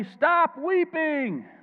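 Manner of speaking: high, very slow cry of the elder; dramatic elder. Acted. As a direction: stretch it out high and pleading. Elder's words read dramatically.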